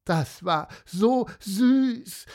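high, slow, swooning gush. A narrator's reading. Sophia gushes about the deer, high swooning voice. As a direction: melt over how cute it was. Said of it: "schwärmte Sophia".

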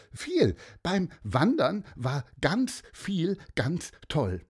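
put-on high young girl voice; lively girl. A narrator's reading. Sophia answers Pieper, high eager child voice.

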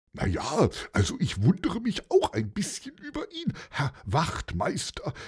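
plain reading voice

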